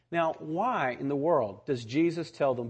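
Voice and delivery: normal talking voice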